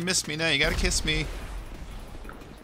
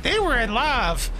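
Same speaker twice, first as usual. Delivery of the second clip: a hint of a starry-eyed kid, higher and slow; dreamy child voice; 11 semitones higher, slower than usual. Recalling childhood view of the couple, lightly dreamy and high. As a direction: lightly voice the kid who believed in romance. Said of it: "as a kid, I was like".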